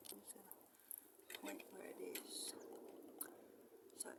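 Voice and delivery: soft hushed whisper; barely audible whisper. Real, not acted. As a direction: whisper as if not to be heard. Creeping close with the camera.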